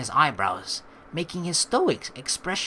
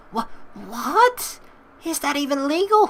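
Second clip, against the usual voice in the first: shocked Marina; 10 semitones higher, 4 dB louder than usual. Acted.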